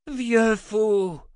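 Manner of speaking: high, loud furious cry; roaring. A narrator's reading. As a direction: hurl it as an insult. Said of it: "rugit Taïra".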